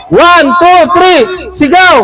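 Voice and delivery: calling out a countdown. Real, not acted. Host cueing young contestant to shout, calling out. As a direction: call it like a starting signal.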